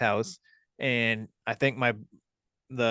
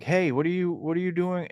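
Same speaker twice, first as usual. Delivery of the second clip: calling out; 7 semitones higher than usual. Retold or imitated. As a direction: call out to a passer-by, pitched up. Calling to stranger at midnight.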